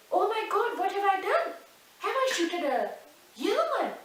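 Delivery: high, loud horrified outcry. A narrator's reading. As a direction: cry out in shock at what he has done. King horrified at shooting a human.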